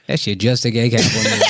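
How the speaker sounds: laughing dismissal. Retold or imitated. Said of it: "And I was like".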